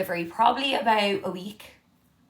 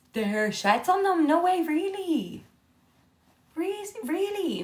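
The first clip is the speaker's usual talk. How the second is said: surprised; 11 semitones higher than usual. Real, not acted. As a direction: react in shocked disbelief. Shocked at sheds on throws.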